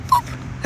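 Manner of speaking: tiny high unvoiced boop; a cute high-pitched boop noise; squeaky boop. Playfully booping the viewer, squeaky boop.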